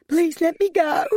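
very high, tearful begging; sobbed plea. A narrator's reading. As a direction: beg to be released, near sobbing. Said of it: "she sobbed".

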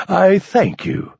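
mock grand thanks. Mock bow to crowd.

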